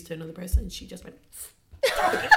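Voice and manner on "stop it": high-pitched retold mum's outburst; stern mum telling-off. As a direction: imitate a mum snapping at an unruly child. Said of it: "Like a real mum".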